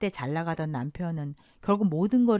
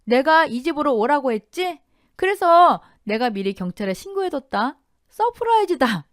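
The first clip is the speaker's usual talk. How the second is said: loud, high, emphatic gloating; triumphant raised-voice taunt; deliberate taunting; 8 semitones higher, 8 dB louder than usual. A narrator's reading. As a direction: spell it out so he hears. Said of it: "천천히 또박또박 잘 들으라고 말했어요".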